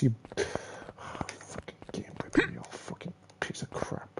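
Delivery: soft whispered curse; muttered swearing at the game; cursing whisper. Real, not acted. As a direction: curse it under your breath. Cursing the game under breath.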